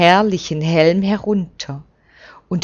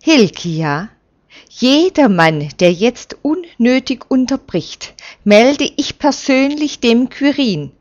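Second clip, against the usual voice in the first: rising, louder and higher rebuke; stern reprimand; 6 semitones higher, 4 dB louder than usual. A narrator's reading. Prince rebukes Hilkia for interrupting, stern warning. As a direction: put the interrupter firmly in his place. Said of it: "der Fürst verweist".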